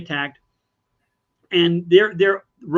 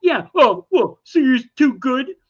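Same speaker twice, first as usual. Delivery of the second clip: higher, louder taunting crowd; mocking sneer; 6 semitones higher, 4 dB louder than usual. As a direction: jeer at him for being too proud. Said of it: "They go".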